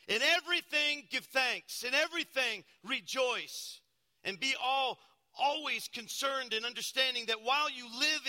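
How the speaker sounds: high, loud preaching cadence; full-force preaching. Acted. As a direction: preach it out to the church.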